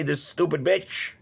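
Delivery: ordinary talk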